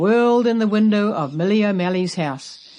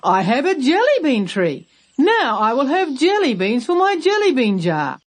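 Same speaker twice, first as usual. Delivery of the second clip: higher and brighter-paced delight; excited; 7 semitones higher, 3 dB louder than usual. Acted. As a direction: crow with glee over the tree. Grandpa delighted with tree.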